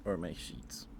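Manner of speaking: ordinary talk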